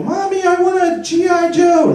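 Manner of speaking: put-on very high child's whine; little kid. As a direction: beg like a small boy. Child voice again.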